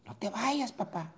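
put-on high, fading child's plea; child pleading. Boy begging his father not to leave, high then fading. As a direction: let the boy's wail drop off at the end. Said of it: "le decía al niño".

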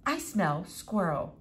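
soft, slow announcement; quiet, measured revelation; solemn pronouncement. Acted. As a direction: state it gravely and slowly.

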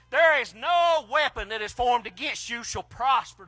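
ordinary talk

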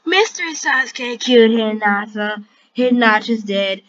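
ordinary talk

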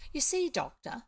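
lightly voiced eager tradesman; a hint of a chatty street seller; cat's meat man character. A narrator's reading.

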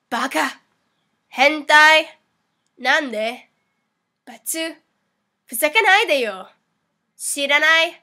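lightly acted tsundere words; a hint of anime tsundere. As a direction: say each word as a separate tsundere retort. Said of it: "do the tsundere voice meme".

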